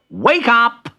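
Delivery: loud, high wake-up call. Acted. Bertie at the rabbit hutch, calling loudly. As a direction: rouse a sleeper with a shout. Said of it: "he called".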